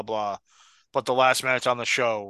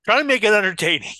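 said again louder after a what; louder, a bit higher restatement; louder repeat; 7 semitones higher, 5 dB louder, faster than usual. Real, not acted. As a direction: repeat it clearly so they hear.

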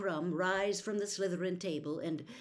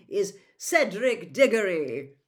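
called out; 10 dB louder than usual. A narrator's reading. Headmaster announcing the Hogwarts champion to the hall, called out. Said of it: "he called".

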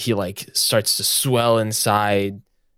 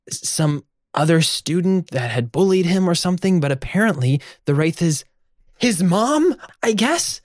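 much higher, anxious fretting; worried character voice; 9 semitones higher than usual. Acted. A character worries to the group about the ghosts.

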